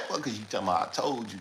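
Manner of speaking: ordinary delivery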